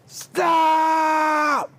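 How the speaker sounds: sudden high shouted stop; a sprinter's sharp yell of stop. Retold or imitated. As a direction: shout it to freeze the runner. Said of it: "and she yells".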